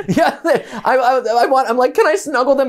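high, giggling eager ask; laughing. Real, not acted. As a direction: laugh through the question. Laughing, asks to snuggle kittens.

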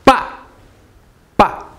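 BA short, falling sharply from high; abrupt falling BA; fourth tone. Shown as a demonstration. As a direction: say BA as a sharp drop. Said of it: "Fourth tone".

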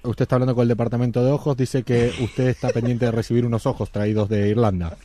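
lightly voiced phone operator; official operator. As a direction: sound like a bored government clerk. Fake Department of Eyes clerk.

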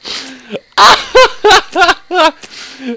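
high loud nervous laughter; shaky relieved giggling. Real, not acted. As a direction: laugh off the fright. Laughs after scare.

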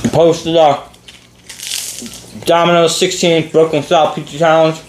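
talking with a full mouth. Real, not acted. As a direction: speak through a mouthful. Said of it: "I'm gonna talk my mouth full".

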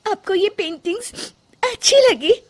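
high, unbelieving question on अच्छी; can't believe they like it; surprised. Acted. Surprised to hear praise. (हैरानी से पूछती बहू)

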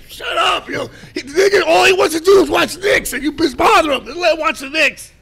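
high, loud comic yelling; mock scolding outburst. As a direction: yell it high, scolding mom on dad's behalf. Acted outburst at mother defending father.

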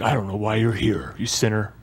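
low, quiet disapproval of a sinner; hushed, judgmental put-down. Acted. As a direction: mutter it with pious disdain.

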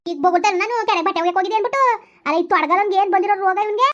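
complaining loudly about him to the neighbour, outraged; outraged complaint. Acted. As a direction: pitch it up in disbelief and rage.

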